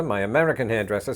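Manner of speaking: usual speaking voice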